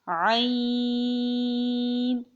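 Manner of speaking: عین held very long on one steady note; five-alif stretch. Shown as a demonstration. Teacher demonstrating the length, long held عین. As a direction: draw out عین from the throat. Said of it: "پانچ الف کی مقدار کھینچ کے".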